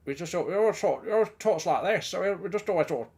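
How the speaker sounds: comic put-on voice of an old workmate; Birchie. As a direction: mimic a workmate in a silly, swooping voice. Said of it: "It's just a voice I've always done".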